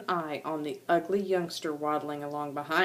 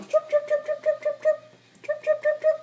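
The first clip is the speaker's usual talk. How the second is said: very high rapid chirping; bird chirps. Retold or imitated. Parent imitates the chirping marsh birds for Stella, very high chirps. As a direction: chirp chirp like a nest of chicks. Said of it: "they're all going".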